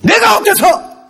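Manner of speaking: high, loud, slow challenge; defiant retort. Shown as a demonstration. Preacher showing how not to be discouraged, defiant shout. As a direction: stretch it out and refuse to cower. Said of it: "딱 얘기해".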